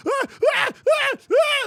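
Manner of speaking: high, loud frightened ah ah; panicked yelps. Retold or imitated. A lady on the bungee ledge yelps, mock terrified. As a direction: yelp in terror like a scared lady at the edge.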